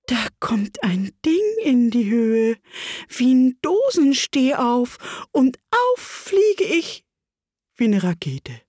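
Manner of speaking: squeaky lizard. Acted. Wabbel describes the blast, high and slow. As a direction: squeak it out slowly. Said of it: "schwache, quiekende Stimme".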